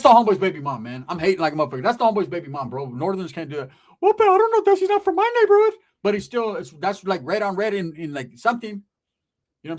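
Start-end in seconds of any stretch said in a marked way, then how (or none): 4.0-5.7 very high, loud comic voice; cousin's put-on voice. Cousin arguing about the girl, high squawky protest. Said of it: "Why does he talk like that?".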